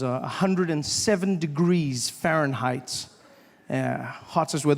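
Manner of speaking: usual speaking voice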